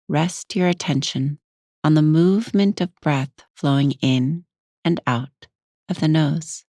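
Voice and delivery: unhurried meditation guide with long pauses; guided meditation. Acted. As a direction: ease the listener inward, leaving space between phrases.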